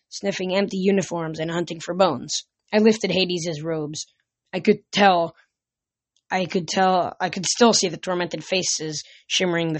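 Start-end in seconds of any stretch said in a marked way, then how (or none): none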